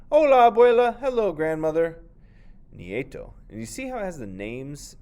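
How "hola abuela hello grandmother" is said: put-on high, loud happy child voice; eager grandson. Nieto greeting his grandmother, high and loud.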